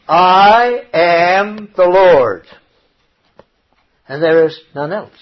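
very slow, loud, drawn-out declaration; solemn scripture proclamation. Acted. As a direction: proclaim God's words weightily, stretching each one. Reading God's words aloud from scripture.

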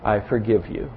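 put-on father softly forgiving his child; tender forgiveness. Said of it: "I'll say".

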